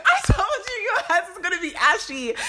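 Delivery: high, loud playful I-told-you-so; shrieked, hammed-up telling-off; mock scolding. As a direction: scold them in exasperation, half laughing. Mock scolding over ashy hands.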